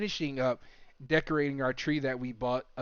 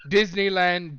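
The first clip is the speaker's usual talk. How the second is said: teasing; 4 semitones higher, 6 dB louder, slower than usual. Acted. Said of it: "She said you're going to Disneyland".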